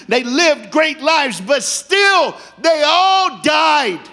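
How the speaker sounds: building from lively to a loud, high preaching roar; preaching at full force. Real, not acted. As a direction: preach it out, climbing to a shout over the music. Preaching climax, rising to full force.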